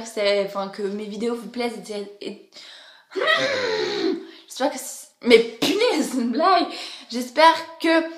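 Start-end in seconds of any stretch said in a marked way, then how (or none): 3.1-4.4 sharp surprised gasp. Real, not acted. Sudden shock mid-outro. 4.5-8.0 startled. Real, not acted. Sudden shock mid-outro.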